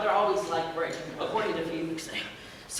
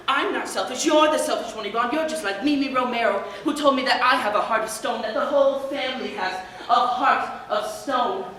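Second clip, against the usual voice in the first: loud, raised retold quarrel voice; indignant mother; 3 semitones higher, 8 dB louder than usual. Son acting out his mother's retort, loud and indignant. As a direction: throw the accusation back, loud and acted out.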